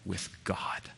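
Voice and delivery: breathy hush on the last words; whispered. Real, not acted. Preacher whispering to the congregation. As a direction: drop to a whisper for emphasis. Hushed close of a point.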